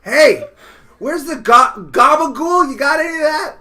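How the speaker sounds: loud, high mock old Italian lady; old Italian woman. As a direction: call out like a nonna by the river. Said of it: "She's eighty-five".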